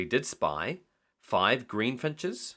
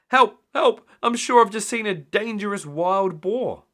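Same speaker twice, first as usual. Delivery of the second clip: high, loud panicked yelling; frightened high cry; panicked cry for help; 10 semitones higher, 8 dB louder than usual. A narrator's reading. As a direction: panic on the phone to Sam. Panicked phone call for help.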